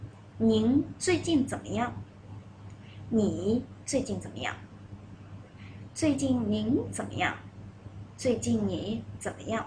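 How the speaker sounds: "您最近怎么样" said clearly again; model sentences. Shown as a demonstration. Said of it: "one more time".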